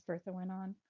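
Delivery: ordinary delivery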